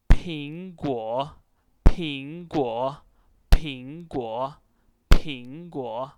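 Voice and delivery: "Pingguo" loud, with a strong puff of air; teacher's model "Pingguo", forcefully aspirated. Shown as a demonstration. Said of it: "pull out your air out of your mouth".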